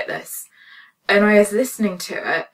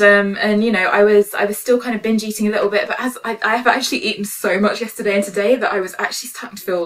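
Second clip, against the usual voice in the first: ordinary delivery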